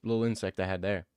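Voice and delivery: ordinary talk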